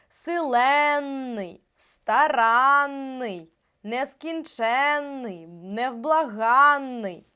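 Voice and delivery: силенний, старанний, нескінченний, невблаганний дуже повільно, з протягнутими «ен», «ан»; тягни наголошений суфікс і подвоєне «н» у «силенний», «старанний», «нескінченний», «невблаганний»; наголос на суфіксі. Shown as a demonstration. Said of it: "Якщо наголос падає на". (дуже розтягнуті «силенний», «старанний», «нескінченний», «невблаганний» з наголосом на суфіксі)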